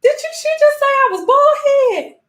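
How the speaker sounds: very high incredulous realization; very high mock-shocked double take; delayed outrage. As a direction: squeak it out as the insult finally sinks in. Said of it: "You'll be sitting there like".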